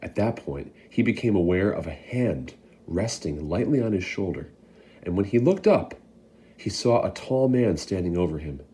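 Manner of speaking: ordinary delivery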